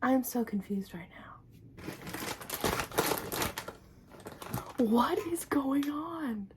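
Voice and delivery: hushed, confused whisper. Real, not acted. Vlogger puzzling over a package, whispering.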